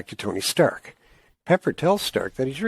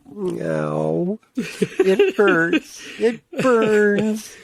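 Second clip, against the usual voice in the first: hammed-up pained cry, rising high and loud; laughing melodramatic pain voice; mock anguish; 10 semitones higher, 3 dB louder, much slower than usual. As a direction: wail it in fake agony with a laugh. Mock suffering at absurd plot.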